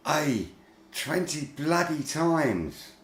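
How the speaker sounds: slow, drawn-out annoyed complaint; exasperated. Real, not acted. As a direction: spell out the frustration slowly. Annoyed at pressing buttons repeatedly.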